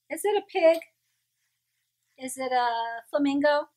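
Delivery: ordinary delivery, nothing marked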